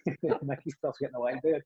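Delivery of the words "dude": normal talking voice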